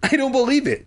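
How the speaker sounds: high, amazed disbelief; astonished high exclamation; gasped. Acted. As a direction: gasp it out high. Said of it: "she gasped".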